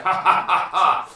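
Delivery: loud, high, hearty victory laugh; triumphant laugh. Acted. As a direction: laugh like the conquering hero.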